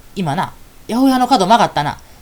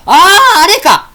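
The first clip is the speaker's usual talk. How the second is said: a loud, high "ah, that one!"; loud realization; 10 semitones higher, 8 dB louder, much slower than usual. Acted. Fairy blurting out loudly to her friend, loud realization. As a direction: blurt it out so the whole street hears. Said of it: "わからんなったら大きな声出しな".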